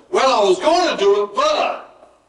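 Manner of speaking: protesting, with "but" thrown up high; excuse-making. Acted. Preacher showing what not to say, mock excuse-making. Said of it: "Instead of going".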